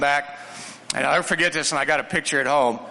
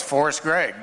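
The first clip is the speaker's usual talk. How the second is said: normal talking voice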